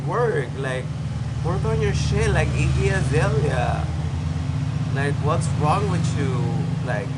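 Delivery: loud, high, exasperated telling-off; exasperated scolding. Pretend. Vlogger scolding a distracted model to focus, loud exasperation. Exasperated pep talk to a struggling contestant.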